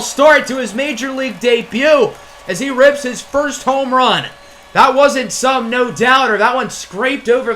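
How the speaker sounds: rising to a loud shout as the ball goes out; building from a big call to a loud, high yell; excited home run call. Real, not acted. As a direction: call it like the crowd is on its feet. Home run call on a deep drive.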